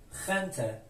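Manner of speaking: خنته opening on a throaty kh. Shown as a demonstration. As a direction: say خنته with g pronounced as kh. («خنته» با صدای خ)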